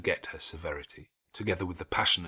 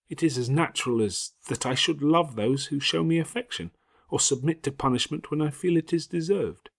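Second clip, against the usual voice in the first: put-on a girl's raised, heated voice; young Jane, indignant; 6 semitones higher, 4 dB louder than usual. A narrator's reading. Jane protests to Helen about injustice, heated. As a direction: argue back hotly as the young girl.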